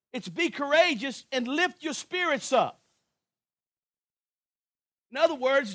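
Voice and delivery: ordinary delivery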